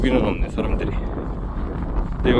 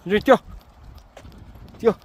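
yelling from the sidelines; 8 semitones higher, much faster than usual. Real, not acted. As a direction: shout at the dogs to run. Urging dogs to run.

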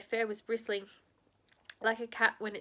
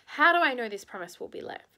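raised, distrustful voice; loud suspicious demand; 3 semitones higher, 9 dB louder than usual. Acted. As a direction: challenge him sharply and loudly.

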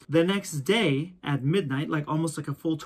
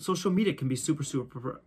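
ordinary delivery

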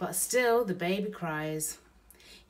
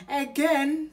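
burglar voice; 10 semitones higher, 9 dB louder than usual. Acted. Bill echoes baby.

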